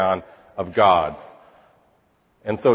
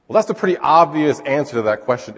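normal talking voice

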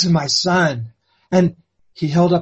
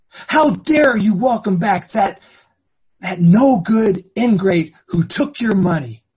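angry tirade; 5 semitones higher, 3 dB louder than usual. Acted. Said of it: "had an outburst and a tirade".

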